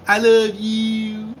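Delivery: ordinary talk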